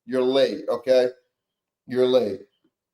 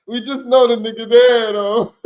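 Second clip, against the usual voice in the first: amused louder retake; loud laughing repeat; 6 semitones higher, 6 dB louder than usual. Real, not acted. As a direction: punch it up louder through a laugh. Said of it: "repeats louder, laughing".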